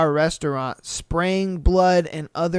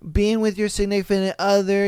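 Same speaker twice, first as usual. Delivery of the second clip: normal talking voice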